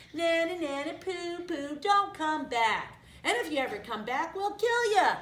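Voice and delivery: high, loud singsong taunt; jeering shout. A narrator's reading. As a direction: taunt them like a playground bully, calling out high. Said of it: "they shouted after him".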